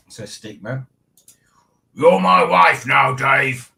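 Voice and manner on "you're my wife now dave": lightly voiced, louder and slow; a hint of a silly put-on voice; funny voice. Reading Tracy's line to Dave, mildly voiced. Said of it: "says Tracy".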